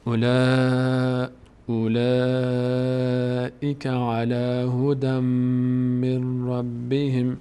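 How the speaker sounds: very slow, chanted tajweed recitation; Quran recitation. Shown as a demonstration. Teacher reciting the verse for TV viewers, slow chant. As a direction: intone the verse slowly and reverently. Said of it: "این آیه رو یه بار از اول بخونیم".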